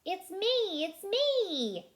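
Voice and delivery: high, lightly voiced duck; lightly voiced playful duck. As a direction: chirp it in a little duck voice. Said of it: "says the duck".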